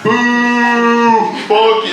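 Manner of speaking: high, loud long boo; crowd booing. Retold or imitated. Crowd turning on the mic man, imitated jeering shout. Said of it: "everyone starts booing me".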